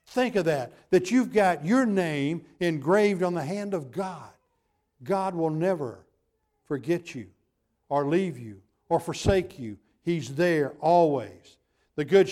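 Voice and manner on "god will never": ordinary talk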